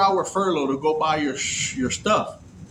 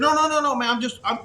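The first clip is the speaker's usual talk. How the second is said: hasty denial; 8 semitones higher, faster than usual. Retold or imitated. Reassuring girl about her phone.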